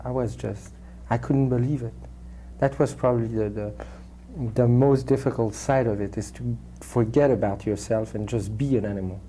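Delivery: normal talking voice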